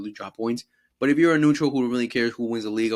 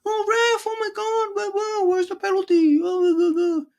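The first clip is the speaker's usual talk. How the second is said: very high whining mock complaint; whiny complaining Vinny; 4 dB louder than usual. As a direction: squeal at the ref like a crybaby. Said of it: "He's complaining to the".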